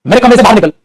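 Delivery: furious command; angry Hindi scolding. Shown as a demonstration. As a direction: throw him out of the room. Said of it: "जैसे अभी हिंदी में डांटा था".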